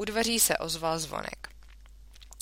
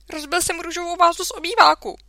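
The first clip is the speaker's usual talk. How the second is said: fast, louder blurted confession; 12 semitones higher, 9 dB louder, faster than usual. A narrator's reading. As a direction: rush it all out in one breath. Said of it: "Vychrlil jsem ze sebe blesku rychle". (rychle vyhrknuté přiznání)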